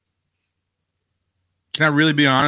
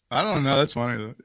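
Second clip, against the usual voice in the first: usual speaking voice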